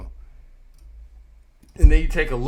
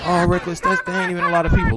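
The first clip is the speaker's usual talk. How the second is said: higher, fast mock viewer voice; 7 semitones higher, faster than usual. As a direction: imitate a dismissive viewer, quick and higher. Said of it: "y'all probably like".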